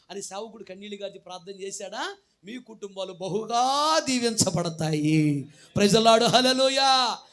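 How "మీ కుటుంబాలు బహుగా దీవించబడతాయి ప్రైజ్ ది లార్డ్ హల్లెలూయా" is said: booming, slow proclamation of blessing; full-force preaching. Acted. Pastor proclaiming blessing over families, booming. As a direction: thunder the blessing out to the hall.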